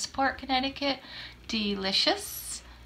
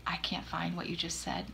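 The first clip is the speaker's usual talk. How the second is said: sheepish whisper; 4 semitones lower, 9 dB quieter than usual. Retold or imitated. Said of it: "had to admit to this woman".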